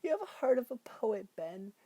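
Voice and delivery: Beverly's voice. Retold or imitated. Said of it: "she's like".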